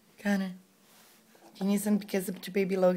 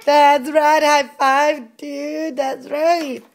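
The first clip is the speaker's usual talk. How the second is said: excited praise; 6 semitones higher, 7 dB louder, much slower than usual. Real, not acted. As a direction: cheer him on for a high five. Cheering toddler's correct answer.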